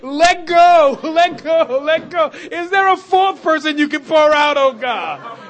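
loud, high pleading preaching; full-force preaching. Acted. Preacher voicing God's call to let go, loud full-force preaching.

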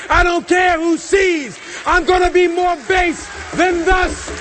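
high, shouted defiant declaration; full-force preaching. Acted. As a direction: proclaim it fervently, pitch held high.

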